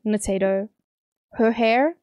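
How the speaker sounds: ordinary talk, nothing marked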